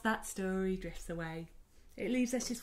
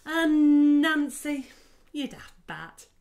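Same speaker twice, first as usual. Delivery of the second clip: fond calling; 6 semitones higher, 10 dB louder, slower than usual. Acted. Grandma fondly calling.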